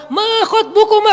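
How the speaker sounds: booming high sermon delivery; loud, high khatib proclamation; preacher's tone. Shown as a demonstration. Preacher wrongly addressing two young women, booming khatib tone. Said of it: "Nada khatib".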